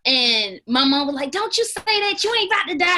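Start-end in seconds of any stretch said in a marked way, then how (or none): 1.3-3.0 scolding mom. Retold or imitated. Mother scolding child about death.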